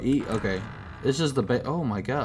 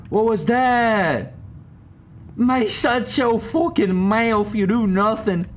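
annoyed outburst at character; 7 semitones higher, slower than usual. Real, not acted. Yelling at a game character.

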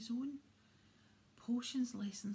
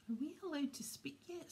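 mildly grumpy, higher-pitched question; 6 semitones higher than usual. A narrator's reading. Ron to Hermione, wanting leave to speak, grumpily. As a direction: ask it with a touch of grumbling. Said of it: "said Ron grumpily".

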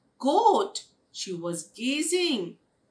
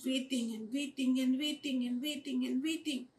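fast, soft string of repeats; monotonous repetition; 2 semitones higher, 6 dB quieter, faster than usual. A narrator's reading. Blackie waits at the pond, quick soft repetition. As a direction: rattle the repeats off on one level. Narrator stresses a long wait.

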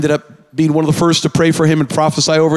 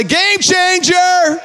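high, slow, stretched call of "Game changer!"; rally the crowd with it; drawn-out shout; 13 semitones higher, much slower than usual. Shown as a demonstration. Preacher leading the church in a shouted response. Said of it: "Someone say".

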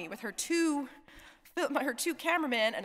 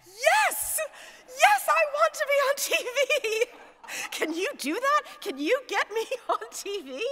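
much higher, louder, gushing yes with laughter; thrilled laughing yes; 6 dB louder, slower than usual. Retold or imitated. Dying Jim begging the chaplain to get him on TV, delighted laughing. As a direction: burst out with delight, laughing through it. Dying patient asked about TV.

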